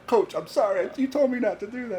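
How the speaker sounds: put-on high, loud apologetic kid voice; sheepish kid. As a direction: play the kid caught out, pleading with coach. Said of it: "And he's like".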